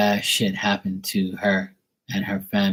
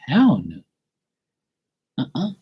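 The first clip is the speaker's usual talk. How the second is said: ordinary delivery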